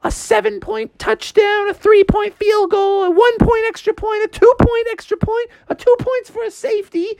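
high-pitched mom voice; high, hammed-up motherly grilling; Froggy's challenging mom. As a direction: fire off questions, climbing to a fast finish.

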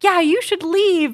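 high, drawn-out polite send-off; polite British dismissal. Retold or imitated. Co-host imitating a baker shooing the host, politely. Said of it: "in a really polite British way".

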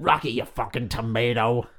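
mock American boxing-trainer accent; put-on American accent. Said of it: "sounds better in that accent".

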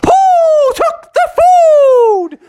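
loud, drawn-out high hoot; slow owl call, way up high. Acted. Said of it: "all they wanna do is say".

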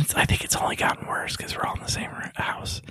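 hushed aside; whispering. Real, not acted. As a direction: drop to a whisper. Drops to a whisper about roommates.

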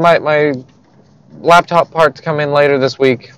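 ordinary delivery

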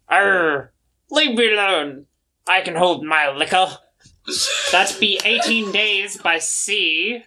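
high hammed-up pirate growl; pirate salamander. Salamander calling to crewmate for drinks, pirate voice. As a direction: bellow it like a tipsy pirate captain.

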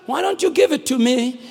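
high, driving sermon cadence; impassioned preaching. Real, not acted. Preacher urging the congregation to hand over troubles, impassioned.

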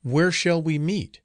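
high, slow, anxious question; frightened girl asking a ghost; fearful questioning. A narrator's reading.

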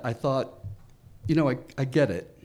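usual speaking voice

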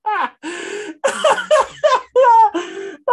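high-pitched cackling laugh; shrieking laugh. Real, not acted.

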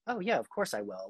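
sincere switch. Retold or imitated. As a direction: drop the attitude and offer help gently. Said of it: "and to being".

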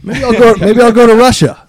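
loud, high joking voice; mock fugitive. Joking he'll flee after shooting, loud mock bravado. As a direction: announce the escape plan with mock bravado. Jokes about fleeing to Russia.

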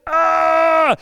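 a sudden loud startled "Ah"; startled gasp. Retold or imitated. Preacher voicing a listener's alarm, loud startled gasp. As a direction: jolt as if something just hit your heart. Alarmed reaction to hearing lies.

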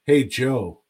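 ordinary delivery

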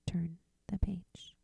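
soft whispered page cue. Real, not acted. Reader whispers to the listener to turn the page.

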